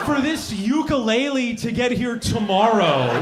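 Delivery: loud, high panicked yelling at the delivery; mock panic. Comic panicking at next-day Amazon delivery, yelling loud and high. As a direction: freak out loudly over fast shipping.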